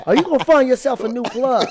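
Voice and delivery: high, loud L.A. street-talk impression; Damson Idris. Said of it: "That's how he talks in the show".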